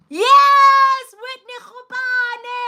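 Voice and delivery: very high, loud yes to a viewer; excited greeting. Real, not acted. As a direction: shriek the welcome with joy.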